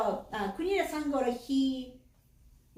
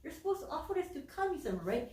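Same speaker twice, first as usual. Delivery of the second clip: hesitant mom; 10 semitones higher, 4 dB quieter than usual. Acted. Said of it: "the mom was like".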